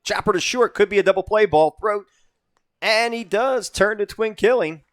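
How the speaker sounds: excited announcer pitch, punching the key words; live play-by-play. Real, not acted. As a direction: call the double play as it happens.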